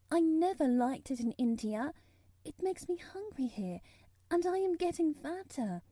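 put-on high child's voice, earnestly explaining; earnest little girl. A narrator's reading. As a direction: play an earnest girl, pitched well up. Narrator plays Mary.

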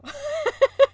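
bright high burst of laughter; high giggle. Real, not acted. Laughing at the cat story with her cohost, high giggle. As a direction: laugh at your own story.

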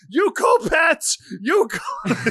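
high, loud cheering send-off; cheering shout. Retold or imitated. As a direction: yell them off like a fan. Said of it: "It's like".

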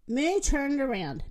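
ordinary delivery, nothing marked

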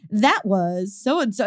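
slow radio DJ drop-in voice. Acted. Radio host back-announcing a song, DJ voice. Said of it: "talking as if I'm a radio D J".

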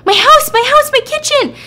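very high, very loud wail; hammed-up panicked shriek over the burning kitchen; frantic scream. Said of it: "she just starts screaming".

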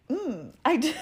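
tearful, laughing through it; crying. Real, not acted. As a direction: choke up and laugh at yourself for it. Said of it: "Are you crying?".

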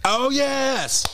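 high, delighted exclamation; excited oh yes. Real, not acted. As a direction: burst out with glee.

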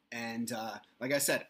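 usual speaking voice

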